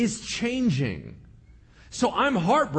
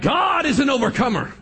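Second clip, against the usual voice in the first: higher, loud mock preacher shout; 7 semitones higher, 6 dB louder than usual. Christians answering someone suffering, loud mock preaching. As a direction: belt it like a revival preacher. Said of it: "And we're like".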